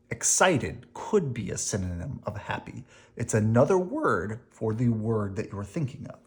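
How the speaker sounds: ordinary delivery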